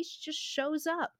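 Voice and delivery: usual speaking voice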